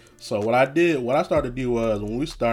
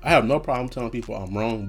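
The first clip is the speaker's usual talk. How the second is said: ordinary talk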